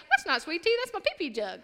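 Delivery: lightly voiced boy, matter-of-fact; a hint of an innocent boy; son's reply.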